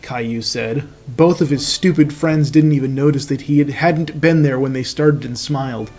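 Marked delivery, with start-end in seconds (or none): none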